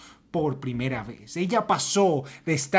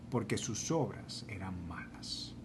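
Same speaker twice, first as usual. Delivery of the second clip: whispered, breathy dramatic aside; 8 semitones lower, 13 dB quieter than usual. Acted. Preacher whispering the verse to the congregation, hushed whisper. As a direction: let the verse end in a whisper.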